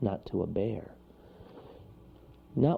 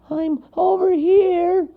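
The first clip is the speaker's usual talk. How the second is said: high, loud, drawn-out call; squeaky far-away shout; character calling out; 10 dB louder, slower than usual. A narrator's reading. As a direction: holler from a distance. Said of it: "came Monroe's voice".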